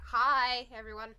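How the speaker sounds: loud bright hello to everyone; loud greeting. Real, not acted. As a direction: call out hi to everyone. Mic aimed at her.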